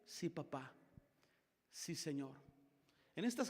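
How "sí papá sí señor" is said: softer, lower obedient reply; a child's quiet "sí, señor"; respectful answer. Shown as a demonstration. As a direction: answer dad humbly. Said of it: "aunque no te parezca bien".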